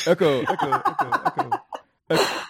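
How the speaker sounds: high calls fading like an echo; echoing under a bridge. Acted. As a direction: call out and let it echo away. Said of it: "It echoes in here".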